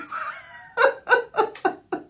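high burst of laughter. Real, not acted. Laughing at the imitation.

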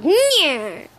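high, drawn-out cartoon "nyeh"; loud "nyeh". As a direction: squawk out one long, loud nyeh. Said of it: "a really loud".

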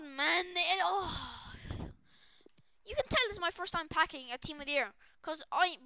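ordinary talk, nothing marked

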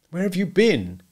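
demanding question, pitched high; suspicious husband. A narrator's reading. Macumber to his wife in the darkness, accusing.

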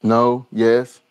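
normal talking voice

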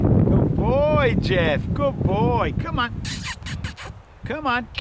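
high, loud praise and urging; calling the dog back. Real, not acted. Trainer to dog gone into the side, high loud encouraging call. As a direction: call out brightly across the field, high and loud.

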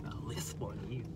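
unvoiced whispered comeback. Real, not acted. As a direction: breathe it out, no voice. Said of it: "whispered comeback".

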